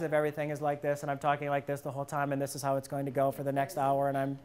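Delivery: low, flat droning demo of a dull presenter; droning monotone. Shown as a demonstration. Presenter mocking a boring speaker, low monotone drone. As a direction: drop way down and drone on without any lift. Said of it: "monotone in the voice".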